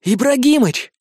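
high, loud eager cry; enthusiastic. A narrator's reading. Ushasty backs the plan, high eager cry. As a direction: jump at the idea. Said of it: "с энтузиазмом поддержал".

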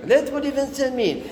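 high-pitched objection to "فانسان ميل"; mock protest. Teacher objects to the seller's French price, high protesting voice. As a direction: push the voice way up in reproof.

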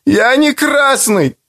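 high, slow tearful cry; a boy sobbing out a denial; mild sobbing boy; tearful wail. A narrator's reading. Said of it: "ревел я, захлебываясь горькими слезами".

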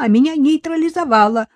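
«нейтрализовала» loud and high, punched; «нейтрализовала» flung out high and loud; the wife's indignant «нейтрализовала»; heated protest. A narrator's reading.

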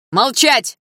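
teacher silencing the heroine; shout. A narrator's reading. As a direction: slam the fist and roar. Teacher slams fist, shouts.

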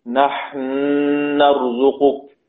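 slow نحن نرزقك with the two ن merged and held; doubled noon, two beats. Shown as a demonstration. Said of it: "نون في النون بتشديد النون بمقدار حركتين".

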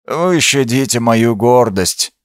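ordinary delivery, nothing marked

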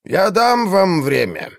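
high, slow telepathic voice; voice in their heads. Acted. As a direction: echo it inside their minds. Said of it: "прозвучал в их головах голос".

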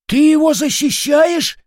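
loud, very high incredulous question; astonished. A narrator's reading. Soldier questions his comrade's loyalty, astonished. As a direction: squawk the question in amazement. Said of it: "удивился Козёл".

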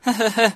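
short high mocking laugh; sarcastic laugh. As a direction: scoff with a laugh. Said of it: "ضحك [name] في سخرية".

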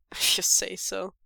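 dismissive scoff. A narrator's reading. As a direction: scoff at him.

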